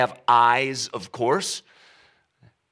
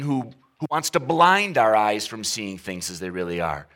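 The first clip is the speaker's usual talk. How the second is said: normal talking voice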